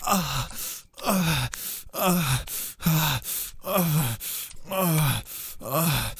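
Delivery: loud, high rhythmic moaning; loud high moans. Acted. Intense moaning during sex.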